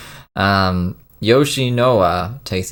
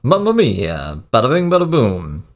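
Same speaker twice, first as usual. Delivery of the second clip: mock Italian accent; 7 semitones higher, 4 dB louder than usual. Viewer riffing with mock Italian phrases, hammed up. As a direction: throw out clichés in a cheesy Italian accent. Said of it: "what kind of accent that was".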